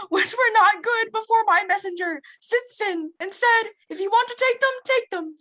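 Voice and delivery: very high, loud squeaky cartoon mouse; hammed-up squeaky mouse, yelling at the end; Mickey voice. Student reading Nani's angry letter as Mickey. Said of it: "start it off as Mickey".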